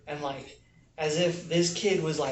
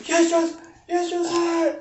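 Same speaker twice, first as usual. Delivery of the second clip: put-on much higher, louder panicky kid voice; scared recruit; 14 semitones higher, 5 dB louder than usual. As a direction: squeak out a frightened yes sir. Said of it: "And he's like".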